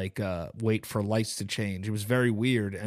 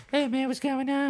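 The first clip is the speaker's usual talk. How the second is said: high, chill dog voice; dog's voice; 13 semitones higher than usual. Pretend. Speaking for his dog Tony, high laid-back dude voice. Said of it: "Tony's voice is like".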